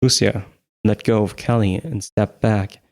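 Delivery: ordinary delivery, nothing marked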